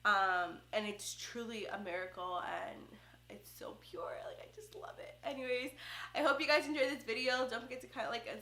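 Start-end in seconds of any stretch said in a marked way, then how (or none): 3.3-5.2 soft, sincere whisper, much softer; tender whisper. Real, not acted. Mom-to-be telling viewers pregnancy is pure, in a tender whisper. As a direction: breathe it like a secret joy.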